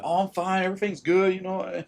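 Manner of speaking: mock-cheerful high lie; fake fine. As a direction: chirp it brightly to cover the hurt. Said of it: "They'll, they'll lie, be like".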